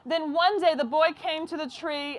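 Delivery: plain reading voice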